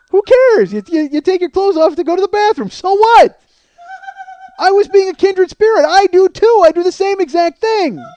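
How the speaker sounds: loud, high-pitched comic outburst; indignant rant. Acted. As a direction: yell it in exasperated disbelief. Indignant rant about bathroom habit.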